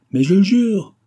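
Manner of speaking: cri haut et fort, voix qui monte; s'écrie. Acted. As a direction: protester en criant de toutes ses forces. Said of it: "s'écria Bidart". (l'accusé s'écrie devant le président)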